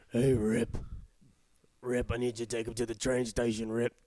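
lightly voiced rancher impression; a hint of a TV cowboy boss giving orders; lightly put-on ranch patriarch voice; John Dutton. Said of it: "John Dutton".

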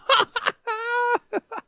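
squealing laugh, way up high; high burst of laughter. Real, not acted. Laughing at the joke.

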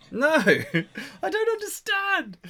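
squeaky high wail through laughter; laughing helplessly. Real, not acted. Trying to get comfortable on stage, laughing high.

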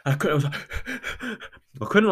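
high, gasping mock sobs; imitated bawling. Runner retelling tears on the road, imitated bawling. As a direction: act out choking up, voice jumping high. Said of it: "I'm absolutely bawling".

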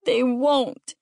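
a touch of tearful protest; child crying. A narrator's reading. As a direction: despair like an upset kid. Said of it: "River cried".

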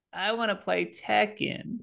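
mock a timid teen friend; imitating friend. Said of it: "you did my voice perfect".

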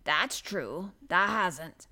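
lightly voiced gardener conceding; mildly sorry for the listener, in rustic dialect; understated, measured agreement; odd, pitying. A narrator's reading. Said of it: "He said it in such an odd way".

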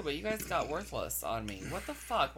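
ordinary delivery

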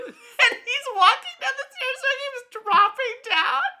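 high, loud, laughing all through; can barely get it out for laughing; laughing hard. Real, not acted. Telling her friend about the spider, laughing hard.

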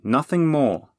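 emphatic last word; firm. A narrator's reading. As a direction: shut the idea down firmly. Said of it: "said Bernie firmly".

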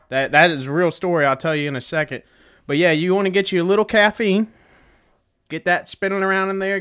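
ordinary talk, nothing marked

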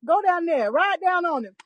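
high-pitched, gleeful retold cheer; excited egging-on. Roommate sitting up in bed, excitedly egging her friend on. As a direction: hype up a friend for a showdown. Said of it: "I'm excited about this".

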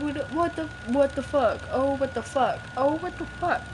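hushed, repeated disbelief; quiet, dazed swearing; stunned disbelief. Real, not acted. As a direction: say it softly to yourself, stunned. Stunned during the game.

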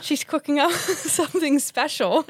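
chuckling through the words; laughing. Acted. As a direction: giggle as you say it.

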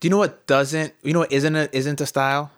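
usual speaking voice

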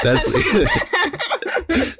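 giggling high through it; laughing. Real, not acted. Friends riffing on a story, laughing. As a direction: squeeze the words out between laughs.

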